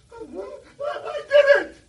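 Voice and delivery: very high, loud laughing cry; kid's shrill protest. Retold or imitated. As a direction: yell it like a panicked little kid. Kid pleads to teacher.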